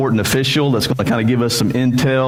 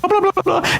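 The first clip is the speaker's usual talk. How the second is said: high wavering trumpet blast, sung on blah; imitating horn tremolo; faster than usual. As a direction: mimic the ram's horn warbling. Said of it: "get that tremolo going under that".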